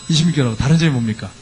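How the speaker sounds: normal talking voice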